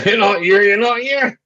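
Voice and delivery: high, loud laughing taunt; laughing tease. Real, not acted. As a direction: giggle it out teasingly. Teasing through laughter.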